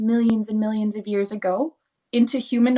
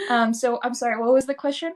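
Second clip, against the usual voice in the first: ordinary talk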